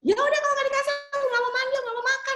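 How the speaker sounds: put-on very high-pitched sulking child; acted tantrum in a squeaky kid voice; child's tantrum. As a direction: refuse like a kid throwing a fit. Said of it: "anaknya ngamuk".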